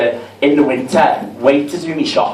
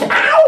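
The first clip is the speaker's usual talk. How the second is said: high mock yelp. Mock pain cry.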